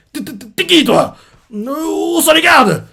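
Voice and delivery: bégaiement paniqué très aigu, fin traînée; affolement. Retold or imitated. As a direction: joue le chef pris de court. Superviseur affolé face au tueur. (le superviseur découvre le massacre, paniqué)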